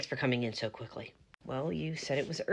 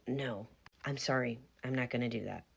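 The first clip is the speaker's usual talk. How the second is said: usual speaking voice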